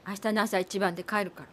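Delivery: low, soft; low soft farewell; quiet resolve. Acted. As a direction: say it drained. Wife quietly announces leaving.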